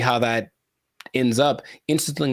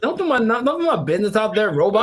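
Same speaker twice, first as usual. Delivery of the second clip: much higher, laughing mock complaint; mock indignant; 11 semitones higher than usual. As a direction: scold the robot in a squeaky huff. Mock complaint to robot checkout.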